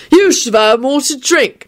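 put-on high, slow drunken call. A narrator's reading. Neville calls from behind the tree, calling out. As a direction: call across, high and drawn out. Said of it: "Neville called out to Lupin".

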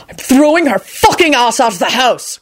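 angry, high-pitched outburst; wrathful shout. A narrator's reading. Quentin threatening to evict Loretta, wrathful shout. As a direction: exclaim in wrath, pitch jumping up. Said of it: "Quentin shouted wrathfully".

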